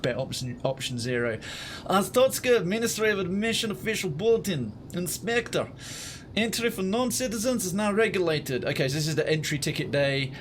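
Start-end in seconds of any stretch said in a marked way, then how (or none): 1.9-8.6 pompous Russian official, pitched up; Russian accent. Pretend. Streamer reads ministry bulletin in Russian accent.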